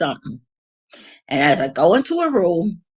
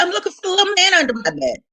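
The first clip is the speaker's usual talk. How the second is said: high, quick mock-mother voice; imitating mother; 12 semitones higher, faster than usual. Mother explains odd search to daughter, imitated high. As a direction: pitch it way up as Mom's answer.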